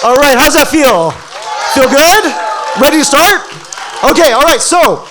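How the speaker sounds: high, loud excited crowd hype; hyped host. Real, not acted. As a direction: pump the room up like an MC. Hyping crowd after cheer.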